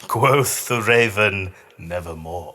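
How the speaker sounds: hammed-up gothic delivery; dramatic raven line. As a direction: boom the setup, then sink into the final word. Dramatic Poe recital.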